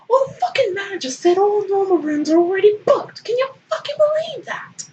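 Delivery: very high, loud indignant rant; Baring ranting about the manager, very high; outraged Baring. Acted. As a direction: screech it in outrage.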